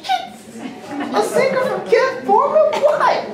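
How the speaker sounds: squeaky high disbelief; shocked inner voice. Retold or imitated. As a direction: gasp it out way up high. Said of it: "And I thought".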